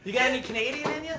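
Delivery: fast, loud teasing line; cheeky innuendo. Acted. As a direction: tease him, rapid and brash.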